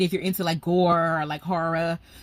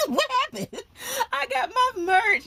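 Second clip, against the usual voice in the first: laughing; slower than usual. Real, not acted. Laughs showing off her merch.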